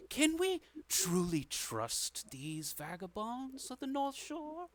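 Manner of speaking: high, slow, suspicious voice; stately high-pitched skeptic in a blue robe; blue-robed councillor. Acted. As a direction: draw it out high and haughty, doubting the newcomers. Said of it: "woman in the blue robe f-, figure goes".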